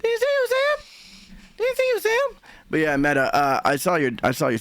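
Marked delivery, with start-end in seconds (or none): 0.0-2.4 high squeaky voice. Pretend. Teasing greeting to a chat member.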